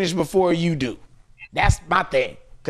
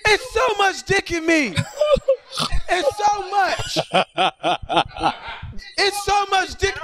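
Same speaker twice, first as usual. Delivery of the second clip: high squealed yelling, way up in pitch; a hammed-up high-voiced girl crying out; mock woman yelling; 13 semitones higher than usual. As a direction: squeal it like the girl mid-act. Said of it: "is yelling".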